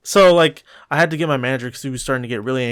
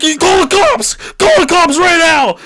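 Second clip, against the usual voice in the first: shrill mock shout, much higher and louder; angry yelling; 8 dB louder than usual. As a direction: yell it at the manager. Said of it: "He was getting pissed".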